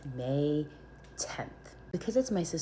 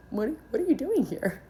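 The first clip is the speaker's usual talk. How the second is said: high, loud stammered question; startled challenge; 10 semitones higher, 5 dB louder than usual. Retold or imitated. Nurse stopping a man in the hallway, startled.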